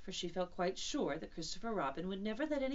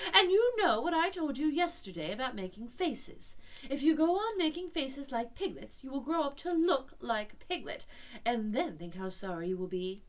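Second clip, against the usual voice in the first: louder, higher motherly scolding; rather severe; 6 semitones higher, 7 dB louder than usual. Acted. Kanga warning Roo about making faces, rather severe. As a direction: lecture firmly, as to a naughty child. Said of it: "said Kanga rather severely".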